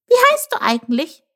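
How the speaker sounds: high little questioning voice; curious Chilp. Acted. Chilp feels out the jackal, high and curious. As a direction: ask brightly, pitched well up.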